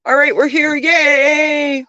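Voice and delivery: high, cheering shout of arrival; excited arrival cheer. Real, not acted. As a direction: call out happily that we made it.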